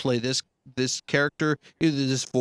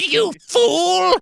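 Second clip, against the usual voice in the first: very high, loud villain screech; hammed-up, piercing evil commander; Cobra Commander; 7 dB louder than usual. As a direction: shriek the insult like a cartoon supervillain. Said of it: "I went full Cobra Commander".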